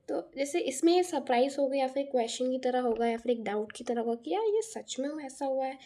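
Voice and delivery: ordinary talk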